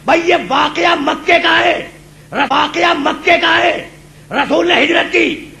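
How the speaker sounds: high, drawn-out preaching cry; impassioned preaching. Acted. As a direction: ring each phrase out to the crowd. Preacher at full force. (پرجوش بلند خطابت)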